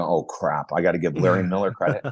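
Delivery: low, rueful mock groan; reluctant low-voiced concession; grudging groan. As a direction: drop the voice, caught out and admitting it. Grudging realization about old coach.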